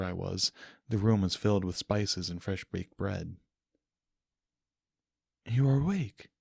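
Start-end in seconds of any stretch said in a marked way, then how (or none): 5.5-6.3 gentle princess. Acted. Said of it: "commented a familiar voice".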